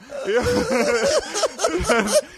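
high, breathy burst of laughter; sudden high-pitched laugh. Real, not acted. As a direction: crack up at the memory. Laughing at his own story.

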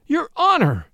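high, loud courtroom outcry; indignant cry. A narrator's reading. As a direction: cry out to the judge, high and falling. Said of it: "he cried".

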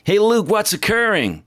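high, hammed-up bad American accent. Host greets his cycling guest on the podcast, bad American accent. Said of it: "I'm doing this bad accent".

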